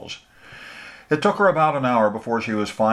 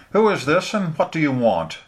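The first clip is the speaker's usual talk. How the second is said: suspicious woman; 8 semitones higher than usual. Acted. Suspicious woman answers the call.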